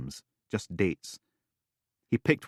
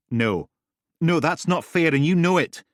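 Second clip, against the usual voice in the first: angry protest; 10 semitones higher, 7 dB louder than usual. Acted. Ellie protests.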